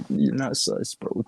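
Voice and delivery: ordinary talk, nothing marked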